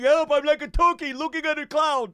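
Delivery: mock drowning panic. Friend mocking the patient's choking story, mock panic. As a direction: make fun of a choking man.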